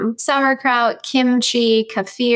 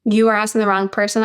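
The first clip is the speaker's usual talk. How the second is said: ordinary talk, nothing marked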